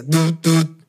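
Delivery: low doubled "du" for message alerts; low-pitched spoken notification noise; imitated phone buzz. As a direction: mimic a phone pinging twice.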